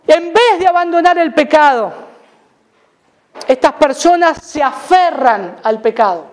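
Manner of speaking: ordinary talk, nothing marked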